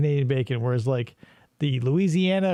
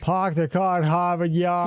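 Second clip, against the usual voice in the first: dropped-r Boston: "Park the car" as "Pahk the cah"; Boston "Harvard Yard" said as "Hahvahd Yahd"; Boston accent. Shown as a demonstration. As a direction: do a lightly put-on Boston accent. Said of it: "Can you say".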